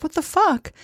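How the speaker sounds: a mock stunned reaction; incredulous. Told to lose weight by a heavier partner, incredulous. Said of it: "you're like".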